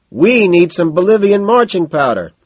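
loud, high sustained yell; high-pitched shouting; scream over music. A narrator's reading. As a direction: holler it across a noisy club. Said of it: "he screams over the music".

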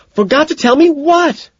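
very high, loud, slow question; angry demand. A narrator's reading. Evelyn pounces on his apology, angry demand. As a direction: demand it at screeching pitch.

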